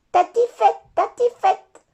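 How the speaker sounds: put-on very high, loud toddler babble; baby brother voice. Little brother mispronouncing tartiflette like a toddler. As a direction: say it like a baby who can't talk yet. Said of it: "c'est petit frère qui dit tatifette".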